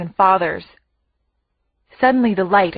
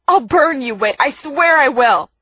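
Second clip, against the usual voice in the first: higher-pitched heated threat; screamed threat; 6 semitones higher, 4 dB louder than usual. A narrator's reading. Sister threatening the brother holding her, screaming. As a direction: threaten him fiercely. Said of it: "I scream".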